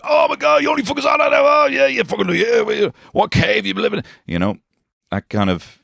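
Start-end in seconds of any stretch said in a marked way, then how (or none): 0.0-4.0 very high, loud mocking shout; mocking group chat jeerer. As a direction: jeer at the late meme sender.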